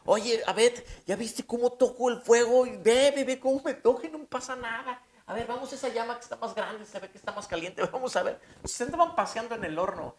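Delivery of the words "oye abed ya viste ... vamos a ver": high, loud, gleeful calls to a friend in the flames; bright excited chatter, much higher pitched; excited playful friend. Retold or imitated. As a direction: act out a delighted young man playing in the fire. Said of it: "Puedes imaginarte a Sadrach".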